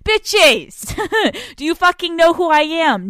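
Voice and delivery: loud, high indignant sass; mock outrage. Real, not acted. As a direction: scoff with diva attitude.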